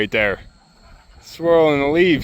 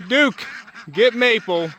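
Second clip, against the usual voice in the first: high, ringing call to the dog; bright high dog call; 8 semitones higher, slower than usual. Real, not acted. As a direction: sing out a command across the yard. Calling farm dog to herd.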